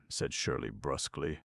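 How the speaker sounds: plain reading voice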